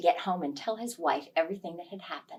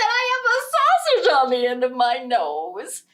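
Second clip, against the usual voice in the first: indignant wife; 5 semitones higher, 9 dB louder than usual. Acted. Wife points out her problem.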